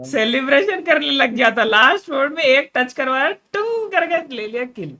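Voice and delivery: high, loud, excited retelling; rising up in excitement. Real, not acted. Retelling enemy kill, rising excitement.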